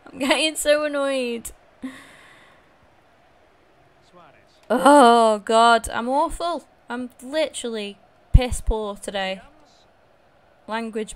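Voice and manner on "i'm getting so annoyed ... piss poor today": loud, exasperated groaning at himself; real frustrated outburst. Real, not acted. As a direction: vent your fury at your own bad game. Furious at own poor play.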